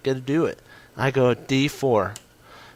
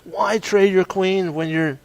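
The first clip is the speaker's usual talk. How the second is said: higher, put-on doubting voice; mock puzzled viewer; 7 semitones higher, 3 dB louder than usual. Chess streamer voicing a puzzled viewer, mock disbelief. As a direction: play the viewer baffled by the queen trade. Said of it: "You're thinking".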